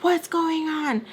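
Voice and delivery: high, drawn-out question; retold alarmed demand. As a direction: imitate someone walking in on a fight. Mister Peach storms in, retold.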